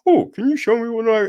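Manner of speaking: mocking quote. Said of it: "But if you're saying".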